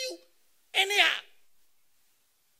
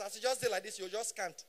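normal talking voice

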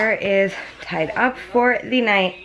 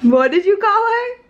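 high, slow mock shock; mock outrage; 11 semitones higher, 4 dB louder than usual. Real, not acted. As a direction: pretend to be scandalized.

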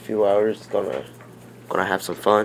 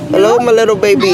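put-on high, loud coo at a baby; baby talk; 11 semitones higher, 5 dB louder, faster than usual. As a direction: coo sweetly to the little one. Cooing to the baby.

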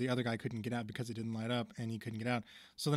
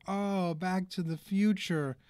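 higher, louder, drawn-out exclamation; excited realization; 6 semitones higher, 5 dB louder, slower than usual. Retold or imitated. Man in the TV remembers his own time, excited realization. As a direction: exclaim it slowly, high and loud.

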